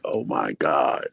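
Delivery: slow, low, fed-up groan of words; weary exasperation. Retold or imitated. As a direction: stretch it out in low despair. Wife retells her exasperation.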